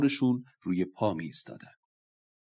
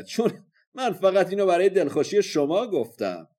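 high, loud warrior's challenge; full-voiced warrior's taunt, pitched high; boastful battle shout; 6 semitones higher, 7 dB louder than usual. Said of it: "فریاد زد".

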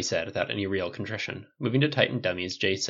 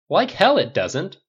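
emphatic; 9 semitones higher, 8 dB louder, slower than usual. Acted. Rhodey's emphatic rebuttal.

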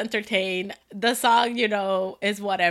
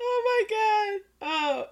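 high, slow laughing oh my god; laughing gasp; 9 semitones higher, 6 dB quieter, slower than usual. Real, not acted. Cohost reacting to the story, laughing gasp.

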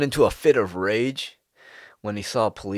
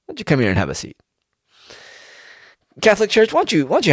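Chris Hansen; 9 semitones higher, faster than usual. Retold or imitated. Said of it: "if I was Chris Hansen".

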